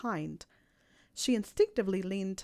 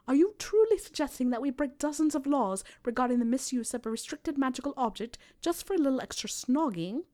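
louder, higher, affronted disbelief; indignant; 5 semitones higher, 3 dB louder than usual. A narrator's reading. Hermione objects to breaking laws for snogging, indignant. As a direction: challenge him in outraged disbelief. She is outraged by his suggestion.

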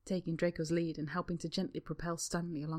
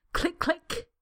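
higher clicking noises; tap clicks; 11 semitones higher, 5 dB louder than usual. A narrator's reading. Draco faking tap-dance clicks for the woman. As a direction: click along like tap shoes. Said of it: "his best attempt at a tap routine".

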